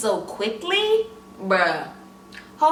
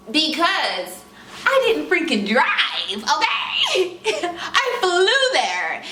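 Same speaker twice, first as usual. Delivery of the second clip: laughing; 7 semitones higher, slower than usual. Real, not acted. Laughing at herself for having flown in. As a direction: break into a big laugh.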